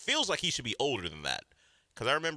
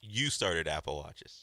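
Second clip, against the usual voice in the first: sarcastic; 7 semitones lower, much slower than usual. Acted. Mocking disbelief.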